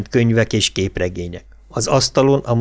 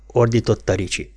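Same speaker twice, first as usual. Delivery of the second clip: plain reading voice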